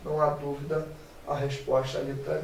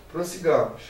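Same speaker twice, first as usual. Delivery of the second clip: normal talking voice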